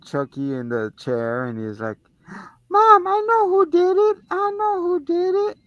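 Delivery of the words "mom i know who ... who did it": put-on high, excited little kid voice; squeaky child insisting; little boy voice. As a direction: play a small boy tattling to mom.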